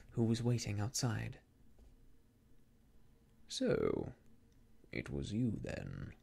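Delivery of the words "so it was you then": low, smiling menace of Mister Rucastle; smiling villain. A narrator's reading. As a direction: purr it low with a false smile.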